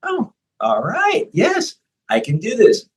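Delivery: surprised into believing in themself; client dawning realization. Retold or imitated. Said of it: "Now they're like".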